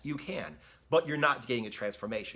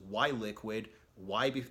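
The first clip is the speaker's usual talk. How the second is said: ordinary talk, nothing marked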